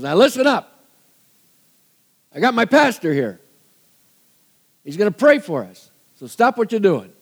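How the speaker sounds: loud, high call over the sub's speaker; intercom announcement. Retold or imitated. Commander on the microphone announcing to the crew, loud and high.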